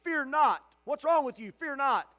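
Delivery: high, loud stern rebuke; angel's rebuke. Acted. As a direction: snap them out of it.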